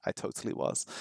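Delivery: hushed, voiceless confession; whispered aside. Real, not acted. Teacher admits eavesdropping on the conversation, whispered aside. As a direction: whisper it under your breath.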